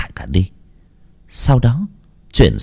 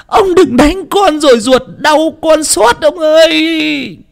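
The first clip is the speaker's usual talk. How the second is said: very high, wailing plea; desperate wife begging through a sobbing wail; tearful pleading. A narrator's reading. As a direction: plead in a shrieky high cry, stretching the last words. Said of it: "miệng mếu máo".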